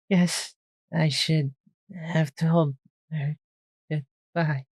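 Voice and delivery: slow, gasping dying words; fading gasps between the words; gasped. A narrator's reading. Dying Twist to Shuja, gasping. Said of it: "she gasped".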